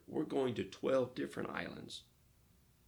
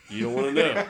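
loud, laughing through the missionary's line; laughing quote; 3 semitones higher, 15 dB louder than usual. Retold or imitated. Missionary to the sick pastor, loud laughing warning. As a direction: deliver it cracking up. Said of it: "He goes".

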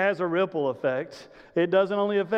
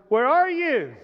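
God calling out; 6 semitones higher, 4 dB louder than usual. Acted.

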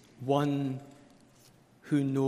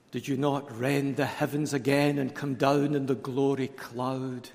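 ordinary talk

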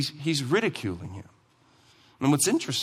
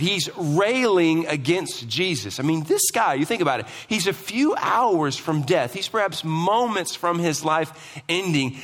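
usual speaking voice

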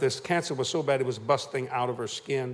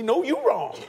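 wife's retort; 11 semitones higher, 5 dB louder, slower than usual. Retold or imitated. Wife retorts in acted quarrel.

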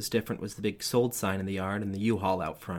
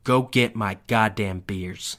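angry father; 7 dB louder, slower than usual. A narrator's reading. Dad's final order.

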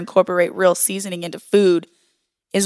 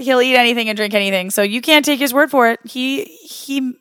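ordinary talk

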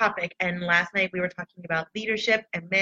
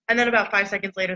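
normal talking voice

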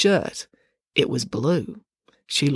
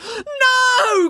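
high, loud wail breaking into tears; anguished crying shout; 5 dB louder than usual. Acted. Animesh seeing his squashed cockroach, sobbing cry. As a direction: cry out as if your heart breaks. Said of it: "cried Animesh, bursting into tears".

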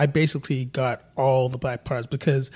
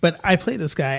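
usual speaking voice

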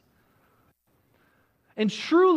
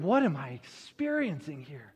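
low, softer mock bewilderment; wondering; 6 semitones lower, 6 dB quieter than usual. Moses wondering at the burning bush, low bewilderment. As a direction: puzzle it over under your breath. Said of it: "is wondering".

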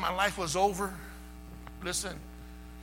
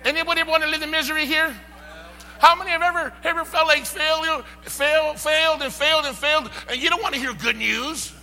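much higher and louder, building fervor; shouted revival preaching; full-force preaching; 9 semitones higher, 11 dB louder than usual. Acted. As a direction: preach it hard to the congregation.

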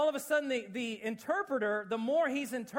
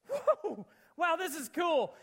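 amazed whoa; 7 semitones higher, 4 dB louder, slower than usual. Retold or imitated. Retold reaction to conversion.

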